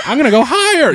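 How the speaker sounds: imitating a high-pitched voice; squeaky mock high voice. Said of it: "made your voice go higher".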